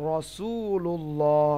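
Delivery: রাসূলুল্লাহ very slow with a thick, full র; teaching the heavy র in রাসূলুল্লাহ, very slow. Shown as a demonstration. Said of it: "রাসূল না বলে কি বলি?".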